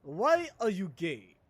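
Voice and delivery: loud, slow mock Nigerian accent; Nigerian accent impression. Streamer quoting a meme interviewer, loud Nigerian accent. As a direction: draw it out in an imitated Nigerian accent. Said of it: "Did you see the one where he's like".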